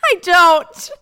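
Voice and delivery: high, loud laughing refusal; laughing protest. Real, not acted. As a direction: squeal it through laughter.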